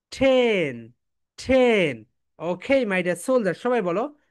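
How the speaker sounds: ordinary delivery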